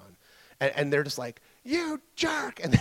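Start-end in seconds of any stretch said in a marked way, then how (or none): 1.6-2.6 high, squealed mock indignation; mock outraged yell. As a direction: shoot the pitch way up as a furious relative. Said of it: "yelled at him again".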